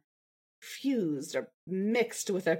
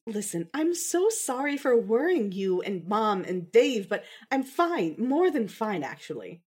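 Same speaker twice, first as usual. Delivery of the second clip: ordinary delivery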